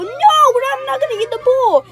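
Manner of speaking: high loud laughing refusal; shouting refusal. Acted. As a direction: yell the refusal.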